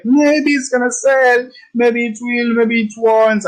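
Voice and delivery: high, drawn-out mock dithering; wishy-washy guessing. Trading teacher mocks those who guess at the market, mock dithering. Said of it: "I'm not talking about guessing".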